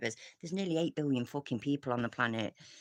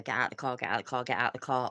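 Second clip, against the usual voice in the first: ordinary talk, nothing marked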